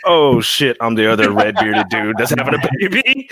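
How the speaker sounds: retold "uh-oh" moment, high and loud at first; mock alarm. Guest recalls seeing the accusing video, mock alarm. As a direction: act out a startled realization.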